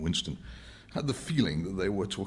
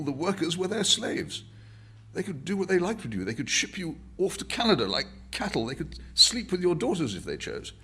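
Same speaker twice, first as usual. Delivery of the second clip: higher, earnest and persuasive; Winston's voice; 12 semitones higher, 4 dB louder than usual. Acted. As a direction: press the point to the old man.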